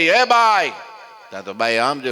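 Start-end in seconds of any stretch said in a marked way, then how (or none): 0.0-0.7 high, loud call across the house. Retold or imitated. Sadhu calling the housewife at night, loud shout. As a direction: shout for the woman to come. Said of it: "બૂમ પાડી".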